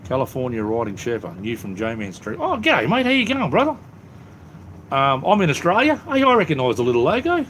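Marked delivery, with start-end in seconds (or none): 2.4-7.4 high, bright burst of delighted hello; excited greeting. Real, not acted. Excitedly greeting a recognised viewer.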